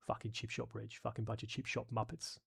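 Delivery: soft, muttered mock insult; muttered British soldier. Quoting soldiers mocking a rival unit, soft and muttered. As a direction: grumble it under your breath like a squaddie. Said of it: "as just, like".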